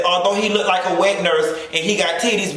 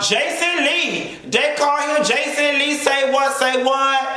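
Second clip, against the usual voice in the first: hype announcer; 8 semitones higher, slower than usual. Acted.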